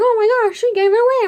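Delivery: high, loud mock outrage; shocked accusation. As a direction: imitate a scandalized player calling out. Outraged player accuses her.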